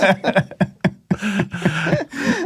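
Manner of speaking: high burst of laughter at own joke; big laugh. Real, not acted. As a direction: crack up at your own punchline.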